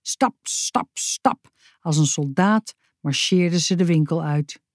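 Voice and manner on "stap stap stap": crisp, unvoiced step sounds; stomping footsteps. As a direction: mimic marching feet in short beats. Stamping off like a soldier. (stampende stappen nadoen)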